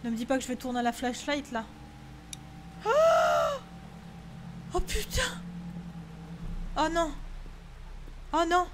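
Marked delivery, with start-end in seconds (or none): 2.8-8.7 scared exclamations. Real, not acted. Frightened by game events.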